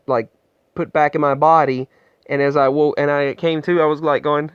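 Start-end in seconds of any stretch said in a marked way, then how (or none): none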